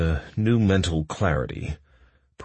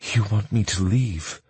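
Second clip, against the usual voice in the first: low breathy voice; 5 semitones higher than usual. A narrator's reading. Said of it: "she asked in a low, breathy voice".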